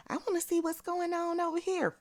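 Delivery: high, squeaky mimicry; sing-song high mock voice; mocking quote. Mocking what someone said earlier.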